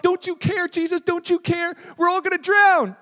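mock terror, pitch way up; frightened men shouting at sleeping Jesus; panicked disciples.

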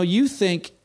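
ordinary talk

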